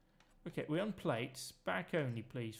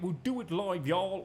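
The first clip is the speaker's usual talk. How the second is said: louder, hammed-up rant; mock rant; 3 semitones higher, 6 dB louder than usual. Gamer quoting a famous rant to viewers, louder mock rant. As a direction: belt it like a TV host losing it.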